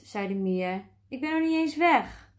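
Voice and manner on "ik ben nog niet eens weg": hoge roep van een afstand; terugroepend. A narrator's reading. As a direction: roep hoog terug, alsof je al wegloopt. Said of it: "klonk de stem nog van". (hoog uit de verte roepen)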